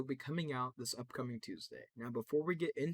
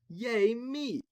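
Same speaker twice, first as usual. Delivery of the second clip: much higher, louder squeal of joy; robot glee; 11 semitones higher, 9 dB louder than usual. Acted. Robot thrilled it's the favorite, very high and loud. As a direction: cheer for yourself like a delighted robot.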